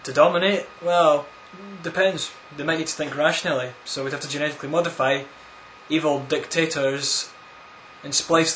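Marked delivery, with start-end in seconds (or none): none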